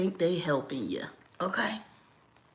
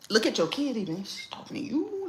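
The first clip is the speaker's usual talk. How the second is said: feigned confusion; 5 semitones higher than usual. Shown as a demonstration. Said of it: "You can also do this".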